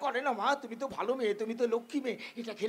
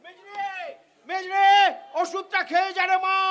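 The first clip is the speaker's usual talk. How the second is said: loud, high call across the house; mother calling for her daughter; 4 semitones higher, 6 dB louder, slower than usual. Acted. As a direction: call her name to find her.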